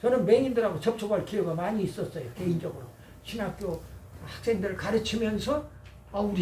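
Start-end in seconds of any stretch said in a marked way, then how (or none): none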